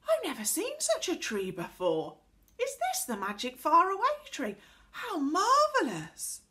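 high, loud rising wonder; amazed. A narrator's reading. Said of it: "enjoying Connie's surprise".

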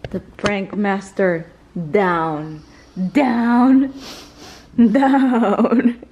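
slow, loud gloating proclamation; triumphant announcing. Pretend. Prankster declares the fooled prank master beaten, gloating loudly. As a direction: crow it out slowly, word by word.

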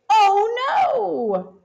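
high, dismayed little cry; mock alarm. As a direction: react to the crash with playful alarm. Car crash in story.

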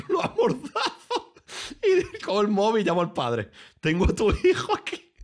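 high-pitched, loud giggling through the words; laughing villain joke. Pretend. Streamer jokingly playing a kidnapper calling the father, laughing. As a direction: cackle while playing the mock kidnapper.

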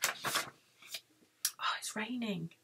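barely voiced, very soft; almost whisper it to the listeners; hushed aside. Storyteller notices rain in the tale, hushed aside.

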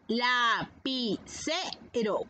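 "Lapicero" slowly, la, pi, ce, ro; slow "Lapicero" with small breaks between syllables. Shown as a demonstration. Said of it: "Vas a repetir la palabra".

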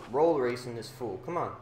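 ordinary delivery

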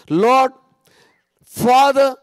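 loud, high invocation; crying out in prayer. Acted. Preacher showing his daily prayer, crying out.

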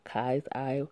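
usual speaking voice

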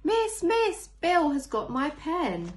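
put-on high, loud, slow tattling child voice; telling tales. Teacher reading a picture book, voicing a tattling child. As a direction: whine it to the teacher like a telltale.

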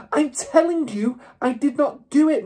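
put-on girl screaming back at the headmistress; screamed. A narrator's reading. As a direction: scream the denial. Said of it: "Matilda screamed".

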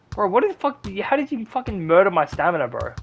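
ordinary talk, nothing marked

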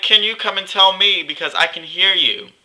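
high, pointed call across the room; mock calling out. Calls out to gossiping classmate.

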